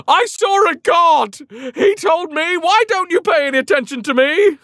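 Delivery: high, loud, wide-eyed blurt; frantic messenger. Retold or imitated. Exhausted runner bursting in to announce he saw a god. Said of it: "he's like".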